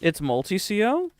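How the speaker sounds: normal talking voice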